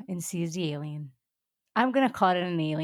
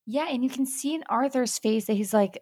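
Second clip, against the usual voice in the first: ordinary talk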